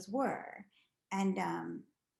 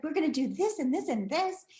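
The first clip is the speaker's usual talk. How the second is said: eager planning; 7 semitones higher, 5 dB louder than usual. Retold or imitated. Mom enthusiastic plans.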